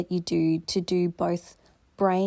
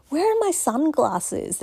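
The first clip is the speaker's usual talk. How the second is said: higher, mildly frantic pretend searcher; mock flustered searching; 7 semitones higher than usual. Someone running around the house looking for lost things, mock flustered. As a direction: act out a light fluster, pitched up. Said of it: "running around the house going".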